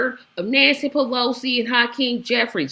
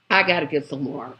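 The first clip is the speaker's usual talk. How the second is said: ordinary delivery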